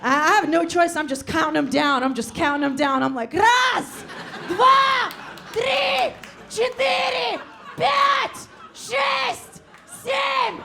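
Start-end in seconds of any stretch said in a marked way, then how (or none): 3.3-10.6 shouted Russian countdown to grandpa. Retold or imitated. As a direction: count him down like a coach.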